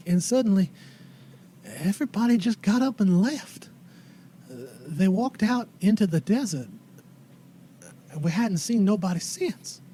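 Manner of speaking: put-on folksy old lady, haltingly telling what happened; old townswoman.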